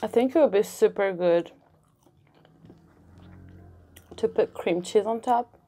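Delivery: talking with mouth full; muffled by bread in mouth; chewing. Real, not acted. Seen 3: bite near -1.0; lips pursed, cheeks full while talking.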